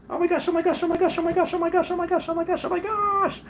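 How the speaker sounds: very high falsetto gushing in a nonstop chant; hammed-up fangirl squeal. Streamer gushing that David liked his post, hammed-up squealing. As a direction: squeal it over and over, as if starstruck.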